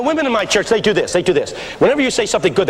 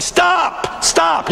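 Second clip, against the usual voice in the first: high-pitched mock cry of "stop"; imitated audience yell; 10 semitones higher than usual. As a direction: comically beg the preacher to quit.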